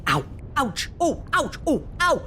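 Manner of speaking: high pained yelps, ouch; yelping as thorns prick; demon yelps of pain. Acted. Demon caught on thorns on the roof, yelping. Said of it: "She heard that demon go".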